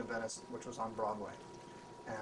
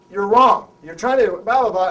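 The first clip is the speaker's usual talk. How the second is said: loud insistent higher voice; retold argument; 8 semitones higher, 22 dB louder than usual. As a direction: argue back hard at the star. Arguing with Hoffman.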